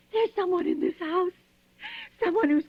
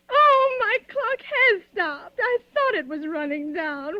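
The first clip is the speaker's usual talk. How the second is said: slow, higher, near tears; tearful fright; 4 semitones higher, slower than usual. Acted. Frightened woman alone at night, tearful and slow. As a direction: let the voice crack and drag.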